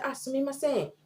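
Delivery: "Ah, sumimasen" with a careful Japanese accent, falling end. Shown as a demonstration. Speaker greeting a stranger, confident Japanese-accented "sumimasen". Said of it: "try to get your Japanese accent a little bit better".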